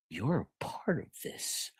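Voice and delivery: slow, breathy whisper; whispered accusation. Acted. Truman whispering his suspicion to his wife. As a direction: lean in and hiss it softly. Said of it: "He says".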